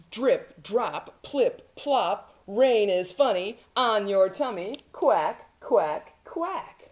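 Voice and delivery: bouncy rhyming storybook read; playful duckling. Acted. Duckling playing with swans and fish in rain, playful duckling. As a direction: lilt through the rhymes, then quack brightly in separate beats. Reads picture book as duckling.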